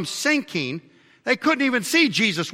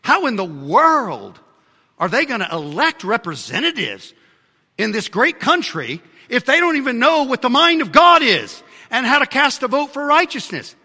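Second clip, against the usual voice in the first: raised, ringing pulpit voice, climbing high; fiery preaching; 8 semitones higher, 6 dB louder than usual. Acted. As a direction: preach it out to the congregation. Preacher building to a climax.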